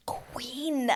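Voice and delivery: hushed, whispered reverence; awed whisper. Retold or imitated. Fan reacting to Edwina speaking up, awed whisper. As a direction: whisper it slowly in admiration.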